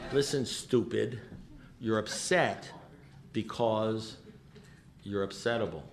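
low, soft, measured pauses between phrases; deadpan verdict. Retold or imitated. Sponsor telling alcoholic why he is upset, deadpan verdict. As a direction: spell it out quietly, pausing before each piece.

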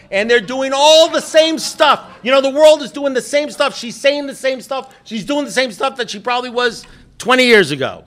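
high, loud comic exasperation; exasperated rant. Real, not acted. Husband complaining about wife and daughters, exasperated rant. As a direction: rant it loudly, leaning on each repetition. Mock exasperation at his family.

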